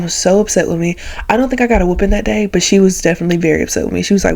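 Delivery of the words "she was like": usual speaking voice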